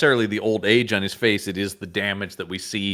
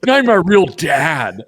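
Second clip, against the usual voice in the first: loud, high, drawn-out hammed-up cry; mock dramatic outcry; 7 semitones higher, 7 dB louder, slower than usual. Co-host riffs on the Skywalker name, mock outcry. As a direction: stretch it into a melodramatic wail. Jokingly acting out a dramatic line.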